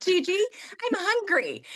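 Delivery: put-on high, slow little-child whine; squeaky slow toddler plea. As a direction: mimic a hungry toddler tugging at grandma. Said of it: "a two-year-old up in my face going".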